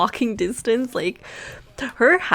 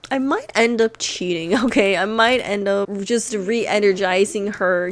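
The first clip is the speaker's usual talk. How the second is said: ordinary talk, nothing marked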